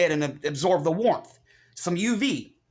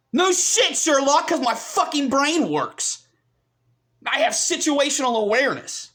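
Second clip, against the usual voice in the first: heated, raised-pitch retort; angry comeback; 6 semitones higher than usual. Real, not acted. Answering critics who call him angry, sarcastic angry retort. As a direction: fire back in real exasperation.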